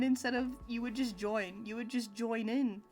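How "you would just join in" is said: ordinary talk, nothing marked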